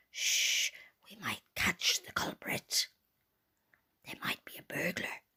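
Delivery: low secretive whisper; hushed warning. Acted. Daddy Bear warns of a burglar, whispering. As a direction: whisper so the intruder won't hear.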